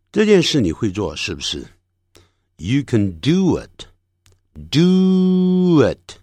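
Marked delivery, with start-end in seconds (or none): 3.2-6.2 "do it" joined smoothly, oo gliding into a short i; teacher models "do it" with a drawn-out glide; linked sounds. Shown as a demonstration. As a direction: stretch "do it" slowly as one linked unit. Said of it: "就产生一个连音".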